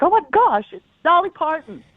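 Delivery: high, excited gasp of recognition; like meeting your idol; starstruck. Retold or imitated. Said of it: "It's like".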